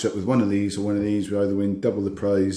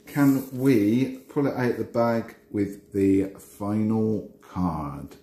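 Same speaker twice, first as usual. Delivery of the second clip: ordinary delivery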